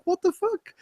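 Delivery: a squeaky baffled aside; mock bafflement. Reacting to a pretend triggered rant, high baffled disbelief.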